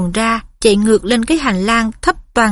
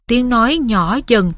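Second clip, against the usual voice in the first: ordinary delivery, nothing marked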